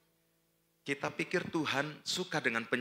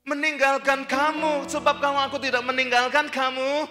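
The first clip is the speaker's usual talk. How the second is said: full-force preaching; 13 semitones higher, 10 dB louder than usual. Acted. Preacher teaching the congregation about surrender, preaching at full force. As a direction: drive each phrase home to the congregation.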